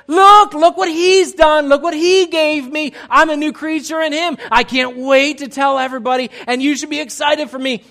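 imitating a newly baptized member, bursting with joy; excited testimony. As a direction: announce it to everyone, beaming. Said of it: "you're like".

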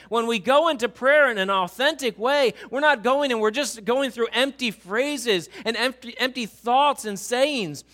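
impassioned preaching. Acted. Pastor urging the church about prayer, full-force preaching. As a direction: preach it out to the congregation.

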